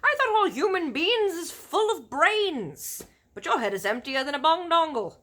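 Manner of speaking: big friendly giant warning about cannibal giants; BFG giant voice. Acted. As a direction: boom out the giant's grim warning. Giant's voice in story.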